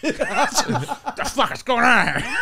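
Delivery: drawn-out growly little tough guy, played for laughs; mock shout as a deep-voiced six-year-old; slow, indignant kid with a man's voice. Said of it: "he got a real deep voice".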